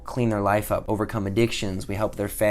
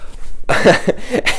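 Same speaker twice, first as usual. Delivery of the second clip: a sudden high, loud laugh; nervous laugh; 11 semitones higher, 9 dB louder than usual. Real, not acted. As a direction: burst out laughing at the memory. Nervous laugh amid the scary story.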